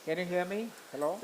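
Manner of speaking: faint, distant line; barely audible. Real, not acted. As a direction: voice far away on a weak connection. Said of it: "Barely audible".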